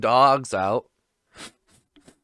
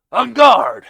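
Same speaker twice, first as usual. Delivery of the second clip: mock battle cry; 9 semitones higher, 10 dB louder than usual. Mock sword challenge.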